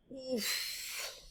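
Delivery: soft strained grunt; tired oof. Acted. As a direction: groan under the load. Big Mouse tired.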